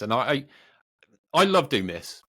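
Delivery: ordinary talk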